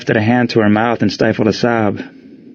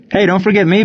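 shouted; 10 semitones higher than usual. A narrator's reading. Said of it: "Billy shouted".

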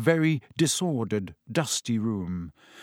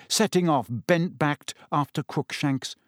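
ordinary delivery, nothing marked

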